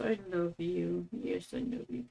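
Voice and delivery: ordinary delivery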